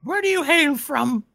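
high creepy voice talking to itself; paranoid, questioning dark creature; eerie creature voice. Acted. Creature talks to Toby and party.

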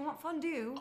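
high, childish British whine, hammed up; mock British accent. Child asking dad for fondue, in mock British accent. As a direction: play a British child pleading. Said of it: "why are you talking in a British accent".

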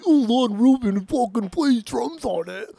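slow, high, hammed-up gushing voice; mock the fan who swoons over the drummer; mocking fanboy gush. Mocking a fan's swoon over the drummer, hammed-up gush. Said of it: "just because".

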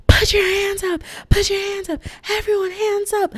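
high-pitched mock police yell; imitated yelling. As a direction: bark orders like panicked cops. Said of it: "they just start yelling".

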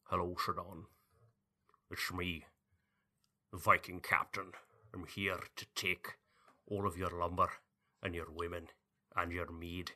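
low, soft put-on Norse captain voice; hammed-up Viking captain, mostly hushed; mock Viking accent. Cohost tries a Viking accent on his co-host, mock Norse captain voice. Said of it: "Historically accurate Norwegian Vikings".

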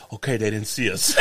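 hushed and relieved with a laugh; quiet relief. Retold or imitated. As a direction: breathe out that they're safe, softly. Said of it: "I went".

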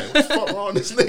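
high, alarmed disbelief; startled outburst. Retold or imitated. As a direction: react like a stranger is chasing you. Man chasing them to the car.

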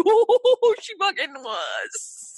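loud high "oh" sliding down; swooping, stretched-out exclamation; dramatic exclamation. Retold or imitated. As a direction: draw it out in mock outrage. Retells her dramatic exclamation.

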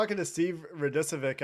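ordinary talk

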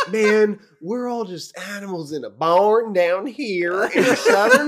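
mocking a buddy's sudden Southern accent. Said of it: "quickly transitioned into a Southern accent".

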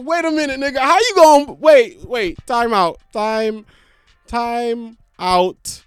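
mock referee calling a foul; outraged disbelief. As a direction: stop everything, you can't be serious. Retold outraged reaction to friend.

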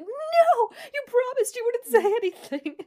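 high, loud panicked plea, imitating Tanya; panicked pleading. Tanya begging Zara not to tell anyone, high panicked squeal. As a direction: squeal it like a betrayed friend begging. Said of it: "Tanya's like".

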